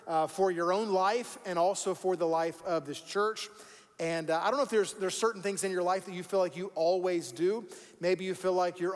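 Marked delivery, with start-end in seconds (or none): none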